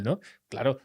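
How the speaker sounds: normal talking voice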